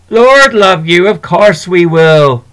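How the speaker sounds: loud, hearty and comforting; hearty reassurance. Acted. Shopkeeper to worried children, hearty reassurance. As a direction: sweep their fears away with a big warm voice.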